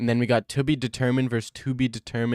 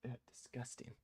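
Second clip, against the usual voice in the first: ordinary talk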